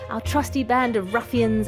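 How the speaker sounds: normal talking voice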